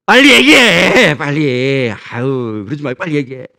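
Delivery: loud, slow impatient shout; impatient demand. Acted. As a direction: demand the real reason at once. Child demands what parent wants. (아버지에게 뭐가 필요한지 빨리 말하라고 다그치는 소리)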